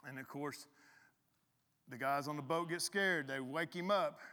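ordinary talk, nothing marked

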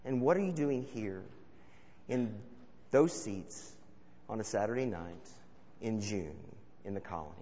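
preacher asks the congregation directly, softly and earnestly; earnest question. Real, not acted. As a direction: ask it gently, personally, word by word. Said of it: "practically asking you".

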